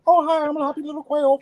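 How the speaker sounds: very high, happy little bird voice; quail voice. Shown as a demonstration. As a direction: chirp it like a cheerful baby quail. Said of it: "do your quail voice".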